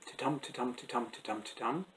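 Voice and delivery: soft, steady "titum" with the weight on "TUM"; quiet "ti-TUM" reminder of the beat; iambic rhythm. Shown as a demonstration. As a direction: run "titum" five times as an even pulse. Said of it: "remember the rhythm".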